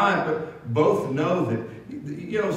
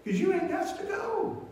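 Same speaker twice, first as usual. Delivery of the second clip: much softer, a lightly voiced old preacher consoling; comforting old pastor; 7 semitones higher, 9 dB quieter than usual. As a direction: ease him gently, deadpan punchline.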